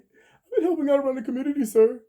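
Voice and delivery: very high, loud impression with laughter in it; Richard Pryor. Defendant pleading community work to the judge, as Richard Pryor. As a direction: squeal it up comically, playing the good citizen. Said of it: "What did Richard Pryor say?".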